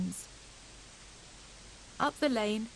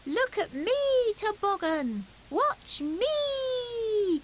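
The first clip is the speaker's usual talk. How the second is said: put-on much higher, louder small-child voice; a tiny hedgehog showing off; little hedgehog crying out; 12 semitones higher, 4 dB louder, much slower than usual. A narrator's reading. Fuzzy Peg calling to the sledgers, high eager cry. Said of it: "cried Fuzzy Peg".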